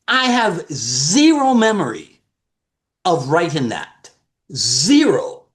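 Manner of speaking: stunned denial, leaning on zero; incredulous. Retold or imitated. To his siblings on the call, insisting in disbelief. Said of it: "And I'm like".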